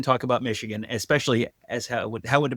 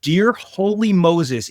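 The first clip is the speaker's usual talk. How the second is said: slow, loud, higher cry of disbelief; exasperated exclaim; 6 semitones higher, 5 dB louder, much slower than usual. Real, not acted. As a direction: drag out the exasperation. Ranting about terrible team.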